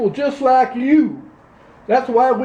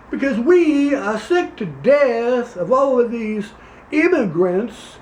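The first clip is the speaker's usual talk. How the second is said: put-on Louisiana accent. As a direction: play a pompous Louisiana candidate, Southern accent. Same character continues.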